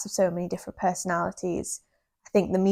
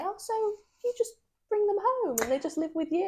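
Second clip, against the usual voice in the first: clueless questioner; 13 semitones higher, 3 dB quieter than usual. Retold or imitated. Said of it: "people will just say".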